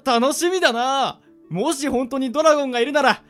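high, loud burst of eagerness; excited anticipation. Acted. Daydreams of riding a dragon. (わくわくして高く弾んだ声)

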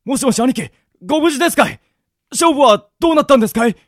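urgent phone call. Acted. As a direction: raise the voice, anxious for the boss. Anxious call to boss on phone.

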